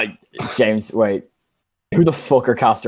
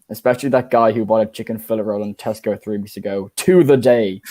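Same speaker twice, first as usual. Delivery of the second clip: ordinary delivery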